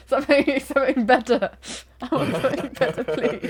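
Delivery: loud plea breaking into giggles; laughing plea. Real, not acted. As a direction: beg through laughter. Pleading through laughter for better name.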